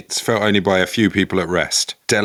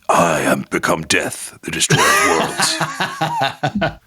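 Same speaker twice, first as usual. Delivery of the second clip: Batman-style declaration; 2 semitones lower than usual. Acted. Batman answering a criminal, hammed-up menace. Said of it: "he comes down and goes".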